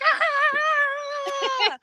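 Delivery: high triumphant scream; long high "aah" of joy; joyful scream. Retold or imitated. Runner getting through the finish arch, joyful scream.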